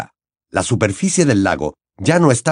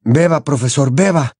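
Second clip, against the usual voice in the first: put-on higher pleading young voice; urging Harry; 4 semitones higher than usual. Harry begging his teacher, pleading. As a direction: coax the old man to drink.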